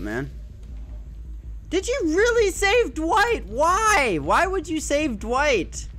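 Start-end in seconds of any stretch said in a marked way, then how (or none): 1.7-5.9 high, incredulous taunt; mock disbelief. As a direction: feign outrage, pitched up. Mocking the survivor.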